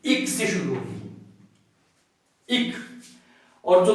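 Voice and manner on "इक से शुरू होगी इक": इक said low and soft; sound of ek. Shown as a demonstration. Teacher repeating the इक sound softly for students. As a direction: repeat इक quietly as a short ik. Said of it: "एक की जो sound है वो इक".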